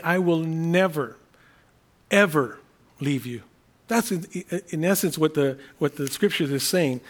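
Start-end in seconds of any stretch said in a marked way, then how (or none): 0.1-3.4 NEVER and EVER each stressed, set apart by pauses; extra emphasis on negative. Shown as a demonstration. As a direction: hit "never", pause, hit "ever", then drop on "leave you". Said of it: "there's an emphasis, um, an extra emphasis".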